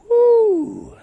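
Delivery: loud, high-pitched "woo"; whoop. Real, not acted. Reacts to the quote just read.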